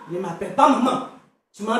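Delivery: mother repeating the refusal, drained; bitter murmur. Acted. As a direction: sink into a hurt mutter. Mother rejects being called maman.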